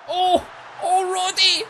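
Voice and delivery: high, loud cry of delight; real burst of joy at the goal; excited cheer. Real, not acted. Goal celebration.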